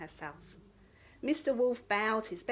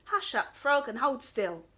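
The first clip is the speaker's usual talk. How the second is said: loud, bossy order; commanding; 3 semitones higher, 5 dB louder than usual. Acted. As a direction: boss him into place. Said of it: "commanded the princess".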